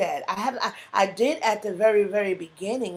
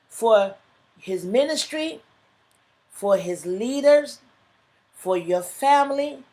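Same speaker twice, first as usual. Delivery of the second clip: higher, louder, hammering each don't; preaching at full force; 4 semitones higher, 5 dB louder, slower than usual. Acted. Preacher urging listeners not to complain, higher and louder. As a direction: rouse the congregation, line by line.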